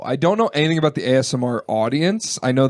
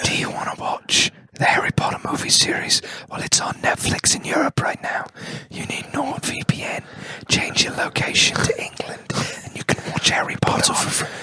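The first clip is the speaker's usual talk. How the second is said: whispered ASMR ad read; whispering. Pretend. Parody whispered VPN ad.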